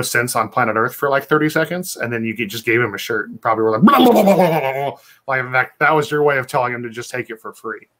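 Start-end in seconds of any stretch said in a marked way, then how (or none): 3.8-5.0 mock babble. Mocking friend's babbling.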